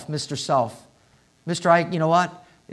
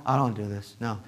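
low, sulky grumble of the lazy self; mock a reluctant, stubborn inner voice; lazy flesh voice; 6 semitones lower, 7 dB quieter, faster than usual. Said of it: "my flesh goes".

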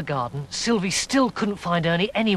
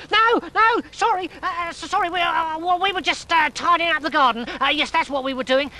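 flustered high voice; 12 semitones higher than usual. Acted. Toads flustered, scared of Dorian.

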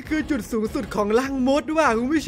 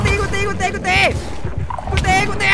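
panicked excitement; 7 semitones higher, 3 dB louder than usual. Real, not acted. Panicking as the monster spawns.

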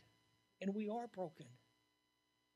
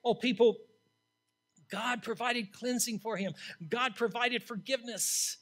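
preaching at full force; 6 semitones higher, 9 dB louder than usual. Acted. Preacher voicing Isaiah's confession, preaching at full force. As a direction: cry it out from the pulpit.

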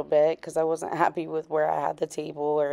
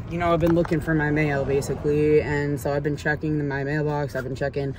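ordinary delivery

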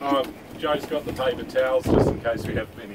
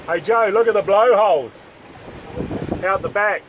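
higher call across the deck; 10 semitones higher than usual. Real, not acted. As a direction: call out to Joe to look. Calling to Joe across boat.

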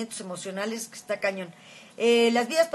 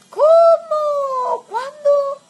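high, loud shocked cries; high startled gasped questions; 10 dB louder, much slower than usual. Real, not acted. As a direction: react in alarm to the bad news. Shocked at friend's injury.

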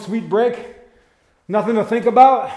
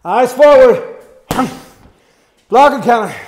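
loud, sharp coach call over music; shouted command; 3 semitones higher, 8 dB louder than usual. Real, not acted. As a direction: call it out to the class. Coach calling out boxing commands.

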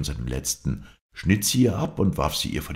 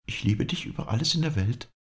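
higher, lightly voiced lady; the lady's reply pitched higher; lady's answer; 8 semitones higher than usual. A narrator's reading. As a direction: answer as the lady, easily promising. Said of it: "antwortete sie".

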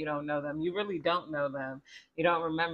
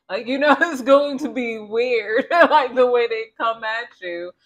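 loud laughing through the words; 7 semitones higher, 9 dB louder than usual. Real, not acted. Tarot reader laughing at the nosy acquaintance, loud. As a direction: crack up while talking.

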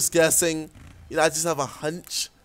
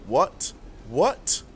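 ordinary talk, nothing marked

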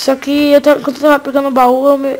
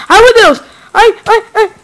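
panicked yelp; 9 semitones higher, 7 dB louder than usual. Real, not acted. Panic as enemy attacks.

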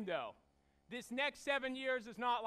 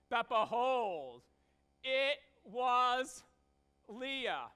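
slow down and hit "behold" louder; slow dramatic reveal; 5 dB louder, much slower than usual. Acted. Preacher reading the punchline of the wedding trick, slow and drawn out. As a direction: drag out the reveal for comic effect. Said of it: "this is just funny".

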